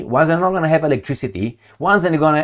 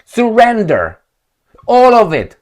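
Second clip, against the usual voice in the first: mock demand; 4 semitones higher, 4 dB louder than usual. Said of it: "I'm not gonna say".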